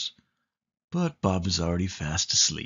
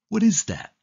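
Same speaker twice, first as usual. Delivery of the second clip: a light, higher little cat asking a bit louder; Pete asking out loud; 12 semitones higher, 4 dB louder, faster than usual. Acted. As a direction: let Pete call the question out into the dark. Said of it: "he asks out loud".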